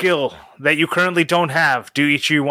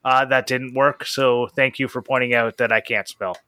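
normal talking voice

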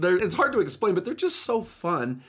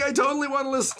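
excited rider; 11 semitones higher, faster than usual. Retold or imitated. Rider reacts to podcast news.

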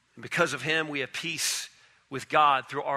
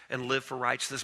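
usual speaking voice

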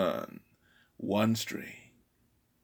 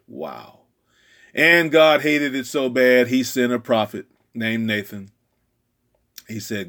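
usual speaking voice

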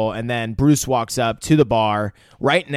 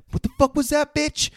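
much higher hammed-up retort; mock tough squeal. Friend retelling John's comeback in a high mock voice. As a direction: bark it back in a squeaky caricature.

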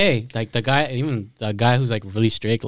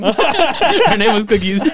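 laughing; 6 semitones higher, much slower than usual. Real, not acted. Cracking up over the name.

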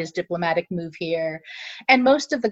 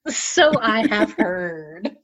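slow, drawn-out knowing remark; 3 semitones higher, much slower than usual. Real, not acted. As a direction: say it slowly with a knowing hint. Repeated slowly, knowing tone.

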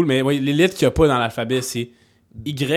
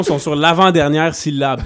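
ordinary talk, nothing marked

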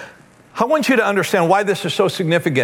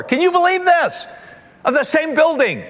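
amazed exclamation; 9 semitones higher than usual. Real, not acted. Preacher marveling to the congregation, high excited voice. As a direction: pitch jumps way up in disbelief.

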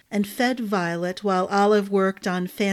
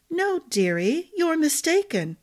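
head cook correcting gently; 8 semitones higher than usual. Acted. Olive gently corrects Arden.

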